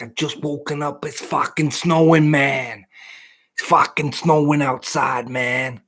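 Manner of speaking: fed-up Bostonian accent, dragging the words out. Shown as a demonstration. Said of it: "you can do the Boston one".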